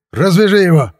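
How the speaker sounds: high, loud command; shouted order. A narrator's reading. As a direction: order him sharply at gunpoint. Armed policeman gives an order. (громкий приказ, высокий голос)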